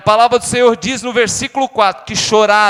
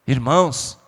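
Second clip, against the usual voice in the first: low, soft, tender pastoral voice; soft intimate preaching; 6 semitones lower, 5 dB quieter than usual. Acted. Preacher consoling grieving listeners, soft and low. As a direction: draw the congregation in gently, much lower and softer.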